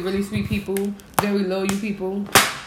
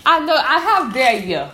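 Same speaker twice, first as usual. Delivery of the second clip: loud indignant how dare you; outraged, voice raised; 4 semitones higher, 8 dB louder than usual. Retold or imitated. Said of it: "That's how I feel".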